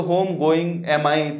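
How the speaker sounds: very slow, word by word; teacher spelling out a wrong sentence, slowly; wrong word order demo. Shown as a demonstration. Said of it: "तो ये क्या हो जाएगा?".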